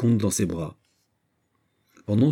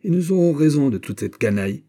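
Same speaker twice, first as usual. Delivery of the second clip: raised, higher fighting call; fighting threat; 8 semitones higher, 5 dB louder than usual. Acted. Fighter calling to the rabble he will punish, threatening. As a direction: call out hotly to an enemy.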